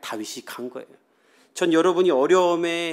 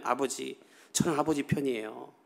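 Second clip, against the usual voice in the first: a touch of a softer, pleading daughter; soft appeasing daughter; 3 semitones lower, 7 dB quieter than usual. Retold or imitated. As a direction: soothe an angry father, voice softer. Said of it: "근데 무슨 말을 하냐면". (아버지를 달래는 딸, 부드럽고 조용하게)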